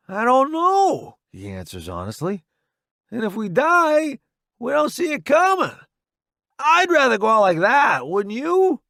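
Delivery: high loud battlefield voice; soldier shouting. Acted. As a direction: call it out over the noise. Roddy answers under fire.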